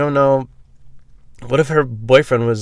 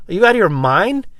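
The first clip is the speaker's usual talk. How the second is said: incredulous shout; 10 semitones higher, 4 dB louder than usual. Retold or imitated. Retold confrontation, shouted quote.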